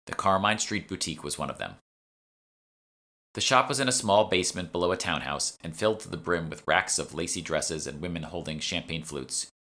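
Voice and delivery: ordinary delivery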